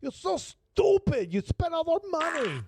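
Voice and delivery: high, slow, shrill-free Rosie Perez voice; high, slow Brooklyn scolding; Rosie Perez impression. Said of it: "spoken by Rosie Perez".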